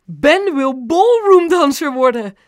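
loud, high, drawn-out proclamation; grand announcement. A narrator's reading. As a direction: proclaim it like a contest winner. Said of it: "alsof ze de winnaar van een danswedstrijd ging bekendmaken". (luid en hoog aankondigen)

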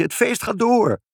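high, drawn-out joyful call; cheering child. A narrator's reading. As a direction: announce it gleefully as a child. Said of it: "riep Ibbeltje".

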